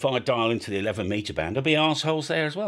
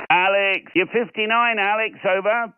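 louder, higher call into the mic; calling back a station on air, raised and upbeat; radio call reply; 8 semitones higher, 5 dB louder than usual. Retold or imitated. Answering Alex's radio call with a raised, higher call.

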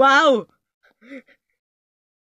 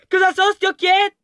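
high, loud startled cry; frightened outburst; alarmed shout; 8 semitones higher, 4 dB louder than usual. Real, not acted. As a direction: yell it as the eyes appear. Spots creepy eyes in game.

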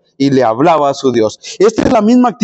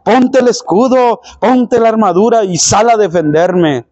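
higher, insistent plea; demanding prayer; 4 semitones higher than usual. Acted. Believer calling on God to defend him, bold and insistent. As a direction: press God urgently to step in. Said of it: "Dígale con el salmista".

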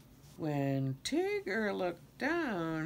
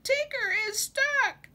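high, loud call; joey calls down the news, high and loud; 7 dB louder than usual. A narrator's reading. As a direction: shout the news down. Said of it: "called Roo".

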